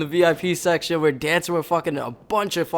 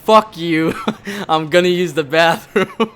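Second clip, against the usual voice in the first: laughing retold outburst; 2 semitones higher, 5 dB louder than usual. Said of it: "Matt says that I was just like".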